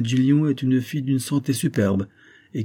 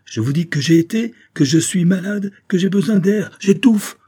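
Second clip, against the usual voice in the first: high, loud outburst, building to the end; mounting, exasperated cry; fit of anger; 10 semitones higher, 7 dB louder than usual. A narrator's reading. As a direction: let anger take over, climbing with each phrase. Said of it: "comme gagnée par un excès de colère".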